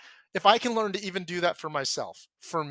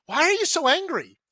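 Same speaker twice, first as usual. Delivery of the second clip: much higher, louder exasperated spouse; wife demanding answers; 13 semitones higher, 6 dB louder than usual. Retold or imitated. Wife confronting her angry husband: higher, louder, exasperated. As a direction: fire the question at him, fed up.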